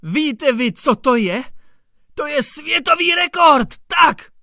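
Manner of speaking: high, loud agitated shout; rising to a triumphant shout; excited shout. A narrator's reading. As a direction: yell it out, carried away by the record. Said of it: "vyhrkl rozčileně".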